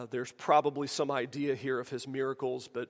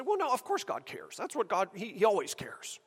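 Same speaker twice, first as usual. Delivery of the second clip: mocking churchgoer; 6 semitones higher than usual. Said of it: "most of us say".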